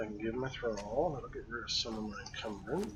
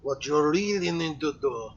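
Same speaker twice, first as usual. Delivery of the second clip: put-on heavy Russian accent, loud; 9 dB louder than usual. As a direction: speak it like a Russian tough guy. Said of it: "Russian accent".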